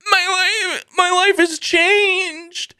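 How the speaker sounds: high, slow teary wail; hammed-up tearful confession; mock weepy. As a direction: parody a patient overcome with emotion. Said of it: "And they're just like".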